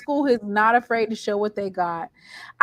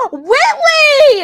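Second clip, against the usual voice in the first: high, loud gasp then name; sudden realization, call it out; excited gasp and call; gasping shout; 13 semitones higher, 11 dB louder than usual. Real, not acted. Suddenly remembering colleague's name.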